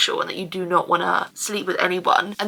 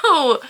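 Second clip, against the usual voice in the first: sudden real yelp of pain, higher and louder; sharp ow; 6 semitones higher, 5 dB louder than usual. Real, not acted. Vlogging to camera, suddenly hurt, a real sharp ow. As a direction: yelp as something hurts.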